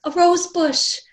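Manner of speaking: high, loud gasp of delight; a child who just found treasure; thrilled discovery. Acted. Wanda to herself, spotting the bush: high thrilled cry.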